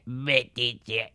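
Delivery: marciano, suave; voz de marciano. Shown as a demonstration. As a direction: imita a un alienígena. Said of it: "un poco con voz de marciano". (voz de marciano)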